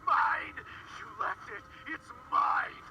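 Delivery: slurred, wild shouting. A narrator's reading. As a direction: scream it, slurring every word. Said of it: "He shouted with a slurred voice".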